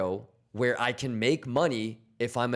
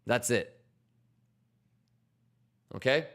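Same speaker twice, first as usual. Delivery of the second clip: ordinary talk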